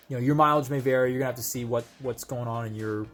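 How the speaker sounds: ordinary talk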